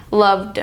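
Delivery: "loved" ending in a voiced "d". Shown as a demonstration. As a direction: end "loved" on a voiced "d" with no air. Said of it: "back of your throat".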